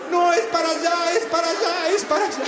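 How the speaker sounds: high, loud frantic es para allá; panicked warning. Acted. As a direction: yell a frantic warning at the field. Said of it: "Y Juancito". (grito agudo y desesperado de Juancito)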